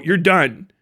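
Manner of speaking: ordinary talk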